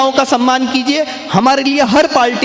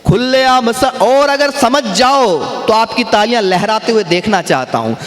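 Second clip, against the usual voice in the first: ordinary talk, nothing marked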